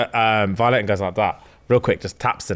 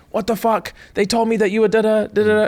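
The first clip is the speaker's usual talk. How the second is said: high hammed-up disbelief; mock outrage; 12 semitones higher than usual. Violet confronting Zayden, mock high outrage. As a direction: squeal the question.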